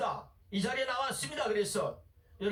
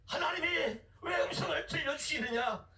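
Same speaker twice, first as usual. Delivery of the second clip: ordinary delivery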